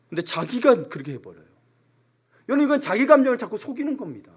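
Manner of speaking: ordinary delivery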